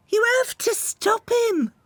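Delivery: very high, urgent little bird begging; pleading bird voice. Acted. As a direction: beg them like a small frightened bird. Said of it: "Misty pleaded".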